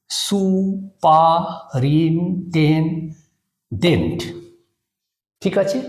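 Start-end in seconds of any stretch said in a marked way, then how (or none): 0.1-4.2 slow, separate syllables with pauses between. Shown as a demonstration. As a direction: break the word into সু, পা, রিন টেন, dent. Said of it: "এরকম করে syllable করি". (প্রতিটি syllable আলাদা করে, ধীরে ধীরে)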